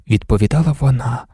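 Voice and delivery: ordinary delivery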